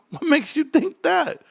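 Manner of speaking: high, drawn-out challenge; mock incredulous. Real, not acted. Streamer challenging a viewer's doubt, mock incredulous. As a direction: question it high and slow, stretching the words.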